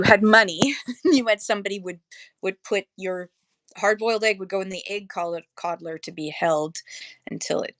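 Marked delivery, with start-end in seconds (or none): none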